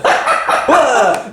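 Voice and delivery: sudden high gasp of alarm; high startled cry. Acted. Jane waking to the fire, a high startled cry. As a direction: cry out at the smoke.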